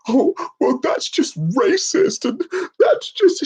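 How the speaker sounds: high, slow whining mockery; drawn-out high mock whine; mock whiny complaint. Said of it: "if I went and like".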